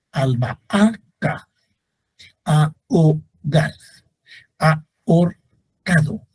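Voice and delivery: each word said slowly for the class to copy; slow, careful teacher dictation of words. Shown as a demonstration. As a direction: dictate the examples slowly to students. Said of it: "Ejemplo de hiato con H intermedia".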